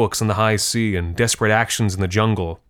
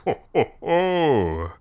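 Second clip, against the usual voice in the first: hearty higher Santa ho ho ho; mock Santa laugh; 5 semitones higher, much slower than usual. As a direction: play Santa for the kids.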